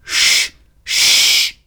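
two long breathy shushes; urgent hushing. A narrator's reading. Ford hushes Arthur during the din, two long shushes. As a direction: shush him to make him listen.

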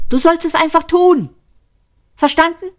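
high yelled order; shouting. A narrator's reading. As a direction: yell it at the robot, fed up. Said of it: "brüllte er".

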